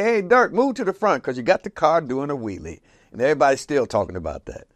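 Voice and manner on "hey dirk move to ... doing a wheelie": high shout that eases off; hollering to the back. Retold or imitated. Driver hollering at a friend in back, loud call. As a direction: call back over the crowded car. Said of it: "I hollered in the back and said".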